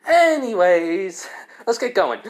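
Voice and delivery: high, loud, drawn-out change of subject; acted brush-off. Kaneko walking away from her slip, breezy brush-off. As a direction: stretch the first word high, then move on.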